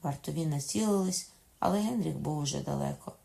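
usual speaking voice

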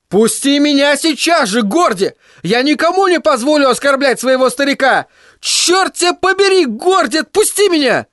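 высокий мальчишеский крик; ори, защищая отца; орал. Acted. Тедди вырывается у Горди. (Тедди орёт на Майло)

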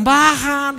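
loud mocking crowd yell. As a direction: shout "Bajan" like a whole crowd mocking. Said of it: "todos gritaron".

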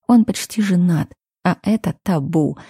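usual speaking voice

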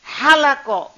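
Halako with a light breathed h, falling at the end; soft h. Shown as a demonstration. Teacher repeating the word list so students hear each h.

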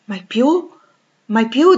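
high, loud, sharply rising questions; incredulous question. Acted. Rochester doubting Jane's promise to stay, incredulous. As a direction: echo it in astonished doubt.

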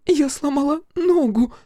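put-on very high, pained little-girl wail; frightened little-girl voice. A narrator's reading. Fiona tells Crow of her injury, frightened little-girl voice. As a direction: wail it high like a hurt child. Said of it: "испуганным голосом маленькой девочки".

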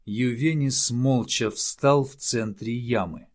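ordinary delivery

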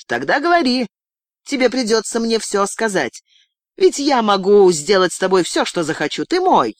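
high, forceful menace; domineering threat. Acted. Girl claiming total power over her captive, domineering.

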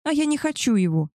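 high, petulant refusal without real shouting; mock cry. A narrator's reading. Mistress rejects the arranged match, crying out. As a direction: protest with the pitch jumping up. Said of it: "закричала она".

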